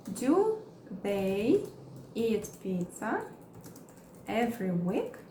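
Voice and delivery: slow, chunked with pauses; slow broken-up teaching read; slow model question. Shown as a demonstration. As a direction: say it slowly piece by piece. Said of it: "Vamos criar mais exemplos".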